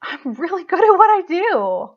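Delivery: high, loud, emotion-filled affirmation; tearful pride. Real, not acted. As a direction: declare it through welling tears. Tearful affirmation after choking up.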